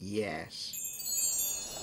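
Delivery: whispered yes; brief uneasy whisper. Acted. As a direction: barely breathe the word, ill at ease. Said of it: "answered briefly".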